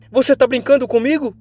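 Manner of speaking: high, loud angry outburst; outraged girl's shout; furious. Acted. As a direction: explode at him, pitch shooting up. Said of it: "começa a cerrar os dentes".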